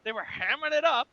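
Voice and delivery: high, drawn-out mock overacting; hammed-up quote. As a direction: stretch the catchphrase out way up high. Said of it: "whenever we talk about somebody overacting, we're like".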